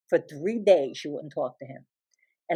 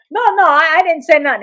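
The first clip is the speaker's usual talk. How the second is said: put-on protesting child; 8 semitones higher, 6 dB louder than usual. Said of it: "I go".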